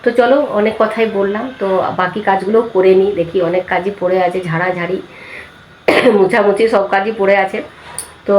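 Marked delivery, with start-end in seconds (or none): none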